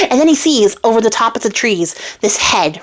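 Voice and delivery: ordinary delivery, nothing marked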